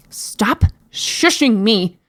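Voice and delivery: slow, drawn-out demand; understated scream, indignant and emphatic; mock scream. A narrator's reading. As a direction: stretch each word in exasperation. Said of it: "screamed at the top of her lungs".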